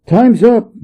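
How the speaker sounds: high, loud yell of impatience; Monkey bellows that time is up; impatient shout at the thunder generals. A narrator's reading. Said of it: "he yelled at the quaking thunder generals".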